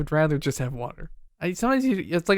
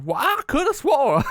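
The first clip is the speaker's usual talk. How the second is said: put-on high slow old gold-miner drawl; old prospector; 9 semitones higher, slower than usual. Shown as a demonstration. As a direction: play a crusty old prospector. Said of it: "Yeah, I sound like a prospector".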